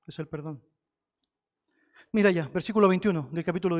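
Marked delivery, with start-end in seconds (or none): 0.1-0.6 hushed echo. Real, not acted. Softer echo of key word.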